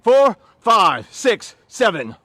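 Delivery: loud drill-style cadence; shouted count. Real, not acted. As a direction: shout the numbers in steady rhythm. Trainer calls out exercise count.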